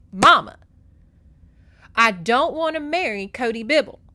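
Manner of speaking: loud, emphatic retold protest; imitating a daughter at her wits' end; acted exasperated outburst. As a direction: burst out loudly, each word driven home. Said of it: "slams her hand down".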